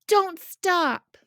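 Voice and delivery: high loud yell. A narrator's reading. Henry yelling at Augie while running, loud. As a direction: urge him on mid-run. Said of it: "would yell".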